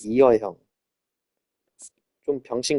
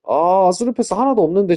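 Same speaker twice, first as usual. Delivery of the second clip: loud complaint; 5 semitones higher, 7 dB louder than usual. Real, not acted. Complaining loudly about game.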